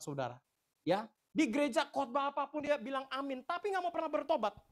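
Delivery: loud, high-pitched warning from the pulpit; preaching at full force. Real, not acted. (khotbah berapi-api, suara lantang dan tinggi)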